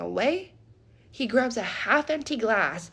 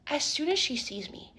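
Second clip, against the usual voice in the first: ordinary delivery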